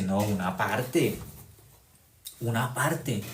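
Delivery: lightly voiced devil, grudging and sly; voice of Satan. Preacher voicing the devil who says only part is forgiven, sly low mock devil. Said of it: "Esa es la voz de Satanás".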